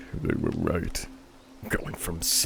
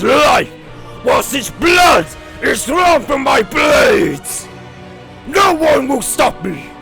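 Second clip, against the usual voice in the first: furious battle cry; 12 dB louder than usual. Acted. Hero vows revenge on Zeus.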